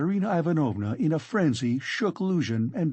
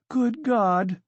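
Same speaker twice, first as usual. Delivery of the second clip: high, sudden cry of dismay; anguished outcry; 10 semitones higher, 3 dB louder than usual. A narrator's reading. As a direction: let it burst out, high-pitched. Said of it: "broke from Sonia".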